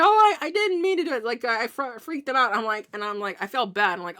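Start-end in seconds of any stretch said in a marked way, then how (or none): 0.0-1.2 startled apology. Retold or imitated. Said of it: "He's like".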